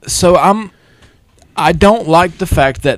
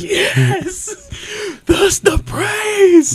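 much higher, slow mock sob; hammed-up crying with pride; mock tearful joy; much slower than usual. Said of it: "And I'm like".

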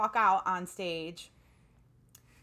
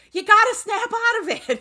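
teacher's voice pushed much higher and louder, breaking into a laugh; gentle teacher raising his voice to snap a nervous student out of panic; raised voice; 15 dB louder than usual. Retold or imitated. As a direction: jolt her out of it, voice raised. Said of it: "raised his voice a little bit".

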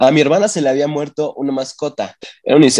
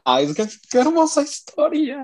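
gushing; 3 dB quieter than usual. Real, not acted. Gushing over love story.

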